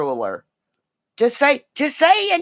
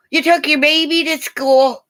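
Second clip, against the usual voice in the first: ordinary talk, nothing marked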